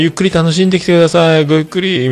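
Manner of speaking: high, gracious voice for the wife; a lightly voiced gracious hostess; polite wife. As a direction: send them off sweetly and politely. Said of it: "みたいな".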